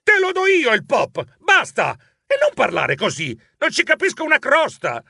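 shrill outburst. Acted. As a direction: yell at the cousin, fed up. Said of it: "Io strillai". (strillo esasperato e acuto)

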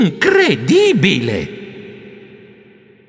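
very slow drawn-out astonishment; slow amazed exclamation; stunned. Acted. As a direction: stretch it out in disbelief. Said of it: "Io non sapevo cosa pensare".

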